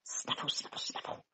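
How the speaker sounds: whispered snuffling; hedgehog snuffle. A narrator's reading. Hedgehog in the garden, snuffling in a whisper. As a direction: sniff about like a hedgehog. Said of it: "went the hedgehog".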